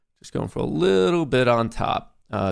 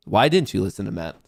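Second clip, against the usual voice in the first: ordinary talk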